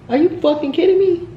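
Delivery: high-pitched, pushed are you kidding me; incredulous outrage. Retold or imitated. Addressing absent Noah, high incredulous outrage. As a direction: fling the question at him in disbelief.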